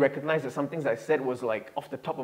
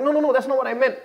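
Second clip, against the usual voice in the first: flustered denial; 11 semitones higher, 7 dB louder, faster than usual. Retold or imitated. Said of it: "But he's like, he's like".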